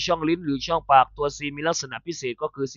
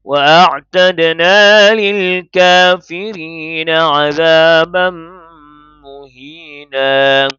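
slow, melodic tartil chant, high and full; Quran recitation; 3 semitones higher, 5 dB louder, much slower than usual. Acted. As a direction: recite each phrase stretched out and sung. Quran recitation before tajweed lesson.

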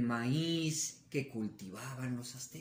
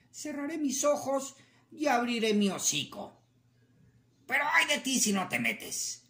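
a hint of a gruff coyote, louder at the threat; coyote agreeing; 7 semitones higher, 4 dB louder than usual. Coyote agreeing but threatening rabbit, mild gruff voice. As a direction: accept then warn sternly.

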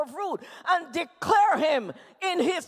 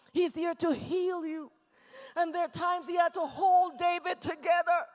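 ordinary talk, nothing marked

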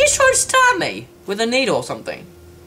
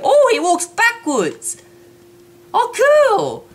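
high, drawn-out amazement; gleeful high-pitched wow; delighted discovery; 14 semitones higher, slower than usual. Real, not acted. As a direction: exclaim high with fresh delight. Delighted by a game detail.

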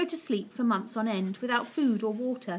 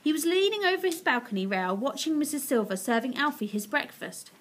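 plain reading voice

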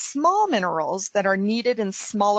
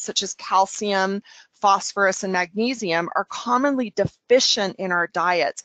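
normal talking voice

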